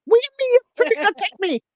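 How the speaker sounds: high, outraged mock retort; indignant Jamaican. Voicing an offended person answering the insult. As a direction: snap back in offended disbelief. Acted quarrel, voiced reply.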